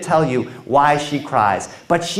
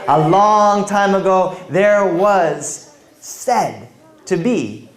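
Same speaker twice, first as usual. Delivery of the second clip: slow, hushed storytelling opening; once-upon-a-time storyteller pace; story narration; 4 semitones higher, 4 dB louder, much slower than usual. A narrator's reading. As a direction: draw the listeners in slowly.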